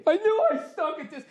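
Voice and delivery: high-pitched mock crying whine. Comic imagining himself losing matches, mock crying. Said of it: "I would have been crying".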